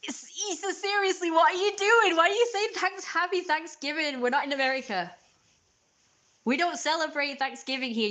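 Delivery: high, incredulous outburst; exasperated rant. Retold or imitated. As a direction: scold in high-pitched, loud disbelief. Ranting at British YouTubers' Thanksgiving greeting.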